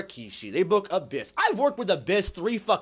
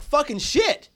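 retold outrage; 6 semitones higher, 5 dB louder than usual. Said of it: "I was like".

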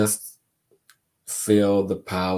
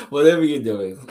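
laughing; 6 semitones higher, 6 dB louder, faster than usual. Real, not acted. Laughs at her own joke.

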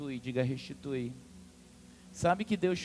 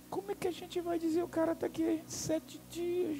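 hurried, hushed worried murmur; nervous whisper; 12 semitones higher than usual. Acted. Doctors whispering nervously among themselves, hushed and quick. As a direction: doctors afraid to break the news. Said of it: "E começaram a cochichar, nervosos".

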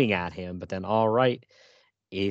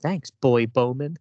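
slow, drawn-out mock-sweet thanks, a little louder; cheesy thank-you; 3 semitones higher, 5 dB louder, slower than usual. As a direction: stretch the thanks like a corny comic heroine. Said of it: "And then she says".

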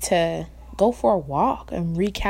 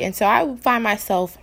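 normal talking voice

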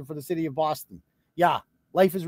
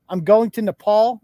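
mock boasting; 7 dB louder than usual. Mocking travellers' boasts.

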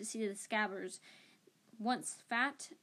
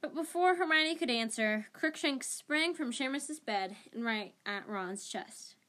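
usual speaking voice

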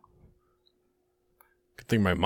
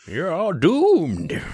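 put-on high, slow doom-saying old man; crazy old man voice; 8 semitones higher, much slower than usual. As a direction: croak out the warning like the camp crazy. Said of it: "That's the old guy".

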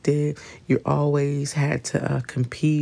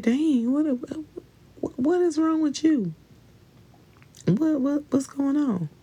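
much higher, baffled and fed up; incredulous exasperation; 11 semitones higher than usual. Retold or imitated. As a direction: ask it way up high in disbelief. Imagined reaction to the complainer.

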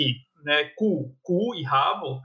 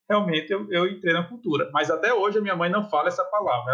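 ordinary talk

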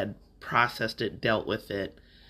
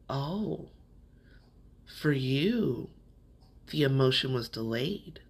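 gentle realization; 3 semitones higher, slower than usual. Retold or imitated. Friend's gentle realization, retold.

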